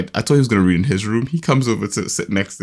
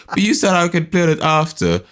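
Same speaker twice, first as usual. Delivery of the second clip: higher-pitched, lightly voiced son; protesting child; 7 semitones higher than usual. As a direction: lightly mimic a kid objecting.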